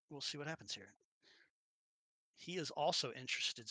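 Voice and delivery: ordinary talk, nothing marked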